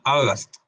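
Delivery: August with O then A sounds, falling; English word demo. Shown as a demonstration. Teacher repeats the word while explaining vowels. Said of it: "y una A".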